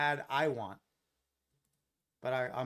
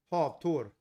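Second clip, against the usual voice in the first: says "hav Thor" in a Swedish accent; Swedish pronunciation; 2 semitones higher, 5 dB louder than usual. Shown as a demonstration. Trader shows his chat the Swedish pronunciation, Swedish accent. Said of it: "So in Swedish".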